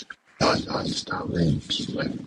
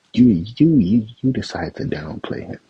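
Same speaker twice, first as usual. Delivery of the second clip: heated outburst; 5 semitones higher, 5 dB louder than usual. Real, not acted. Angry rant at the pundit.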